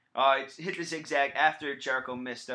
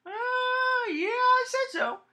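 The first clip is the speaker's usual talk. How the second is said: squeaky voice; 4 dB louder than usual. Acted. Jokey high voice.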